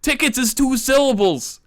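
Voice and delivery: high, heated comic rant; exasperated outburst, voice climbing high; losing temper. Acted. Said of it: "I lost my temper".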